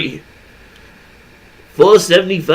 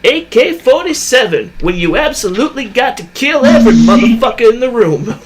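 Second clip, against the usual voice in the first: Sam Jackson line; 7 semitones higher than usual. Retold or imitated. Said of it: "That's like something Sam".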